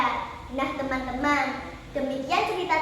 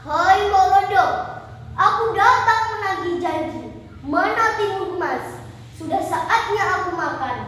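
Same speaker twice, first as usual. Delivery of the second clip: loud, raised giant's voice; demanding giant; 4 semitones higher, 6 dB louder than usual. Acted. Giant comes to Mbok Rondo to collect his promise, booming. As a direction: boom out the demand at full voice.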